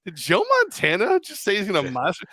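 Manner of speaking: put-on kid's disbelief. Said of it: "as a kid, I remember looking at him and going".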